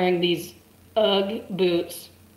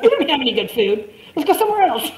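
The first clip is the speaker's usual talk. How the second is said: complaining Germans; 8 semitones higher, 5 dB louder than usual. Retold or imitated. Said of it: "They were like".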